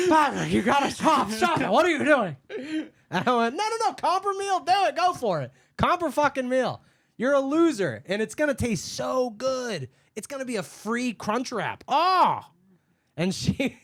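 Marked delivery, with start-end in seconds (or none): 0.1-2.4 loud, higher pleading, laugh nearby; exasperated manager. Retold or imitated. Manager telling his worker to stop, mock exasperation. 3.6-12.4 high, loud sarcastic goading; hammed-up taunt about the freebie; mocking insistence. Worker taunting the customer about her free meal, loud sarcasm.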